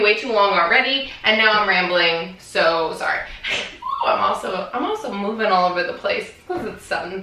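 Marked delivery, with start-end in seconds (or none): 3.2-4.0 a short airy giggle; breathy high laugh. Real, not acted. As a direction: laugh lightly under your breath. Laughs after apologising for rambling.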